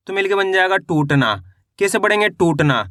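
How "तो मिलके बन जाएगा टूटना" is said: normal talking voice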